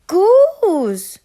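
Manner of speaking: high, loud squawked name. A narrator's reading. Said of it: "squawked Duck".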